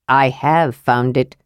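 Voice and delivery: low, slow, unruffled dwarf; Flint, imperturbable. A narrator's reading. Dwarf counters the kender's argument, imperturbable and slow. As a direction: answer slowly and low, not moved at all. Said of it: "said Flint imperturbably".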